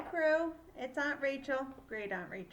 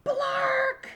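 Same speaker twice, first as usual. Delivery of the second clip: shrill, very loud bird squawk; 12 dB louder than usual. Acted. Little bird squawking at the crew, loud and high.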